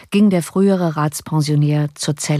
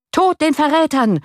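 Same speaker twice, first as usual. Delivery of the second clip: high, loud mob cry; frenzied crowd scream; 10 semitones higher, 3 dB louder than usual. A narrator's reading. Citizens' company screaming at Tilly, high and loud. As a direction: scream it beside yourself. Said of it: "schrie die Kompanie der Bürger außer sich".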